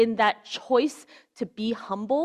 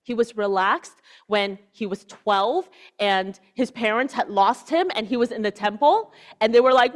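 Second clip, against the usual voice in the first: ordinary talk, nothing marked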